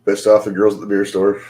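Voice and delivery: usual speaking voice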